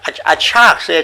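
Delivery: ordinary talk, nothing marked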